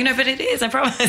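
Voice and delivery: high-pitched, pleading protest; retold insistence. Telling doubting parents the win is real, high insistent protest. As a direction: re-enact insisting, pitched well up.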